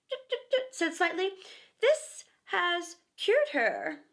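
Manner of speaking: high, slow mock-doctor voice; pompous doctor. A narrator's reading. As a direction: play a self-important little doctor. Slightly plays doctor.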